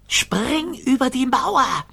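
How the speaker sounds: slow, very high command; high creature call. A narrator's reading. Gigglerin ordering Rover over the wall, slow high call. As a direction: draw the order out in a high thin voice. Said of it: "rief die Gigglerin".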